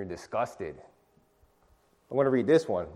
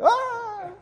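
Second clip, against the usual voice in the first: very high mock shocked ah; hammed-up high squeal of surprise; shocked yelp. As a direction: imitate the guy's startled face with a squeal. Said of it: "He's like".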